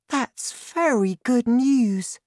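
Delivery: put-on a relieved small girl; high girl voice, pleased; little girl Sophie. A narrator's reading.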